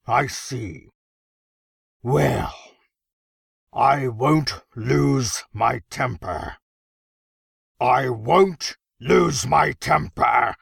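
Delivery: slow, deliberate words with pressure building; barely contained temper. A narrator's reading. Emperor struggling to keep his temper, tightly contained anger. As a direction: hold the rage back, word by word.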